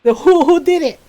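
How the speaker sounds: imitating mom demanding who did it; loud, high mock interrogation; mom's interrogation. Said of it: "she's like".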